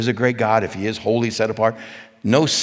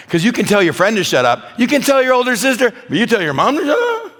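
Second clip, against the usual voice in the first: building to very high, loud disbelief; hammed-up outrage, pitch rising each time; mock incredulity; 13 semitones higher, 7 dB louder than usual. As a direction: climb into a squeaky-high incredulous question. Preacher building mock disbelief at backtalk.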